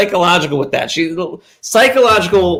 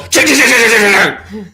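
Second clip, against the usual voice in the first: high, bouncy mimicked shake shake; drawer rattle imitation; 6 semitones higher than usual. Kid recalling mom shaking the drawer, mimicking the rattle. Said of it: "So you just hear her go".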